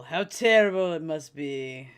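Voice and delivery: loud, slow, drawn-out mock pity; mock lament. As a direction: lay on the pity, stretching TERRIBLE loudly. Mock lament, repeated louder.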